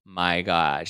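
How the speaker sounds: slow, drawn-out mock astonishment; long, slow gawping disbelief; acted shock. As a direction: imitate a stunned onlooker, stretching it out. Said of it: "Look at her face. She's like".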